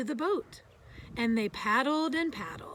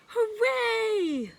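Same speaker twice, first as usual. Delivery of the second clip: much higher, loud cheer; joyful shout; 11 semitones higher, 7 dB louder than usual. A narrator's reading. The four friends cheering together, high and loud. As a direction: cheer together at the top of your voice. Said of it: "shouted Little Beaver and the duck".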